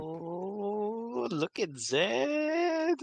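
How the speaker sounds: high, drawn-out swooping "ooh"; teasing coo. Acted. Friend teasing Zed over the flower, delighted high coo.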